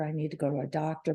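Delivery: "I need to go to a doctor" rushed out fast and low, like a nagging thought; fast repeat to herself. Retold or imitated. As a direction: hurry the line under your breath as you tell yourself again.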